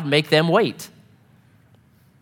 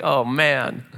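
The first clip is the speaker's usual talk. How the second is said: mock despair; 4 semitones lower, 3 dB quieter than usual. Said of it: "I'm like".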